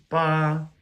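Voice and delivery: BA held high and level, sung out; first tone. Shown as a demonstration. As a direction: sing BA on one flat high pitch. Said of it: "high pitch tone".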